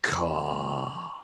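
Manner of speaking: drawn-out raven call; raven caw. Acted. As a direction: draw out one slow caw. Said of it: "turns back to him and goes".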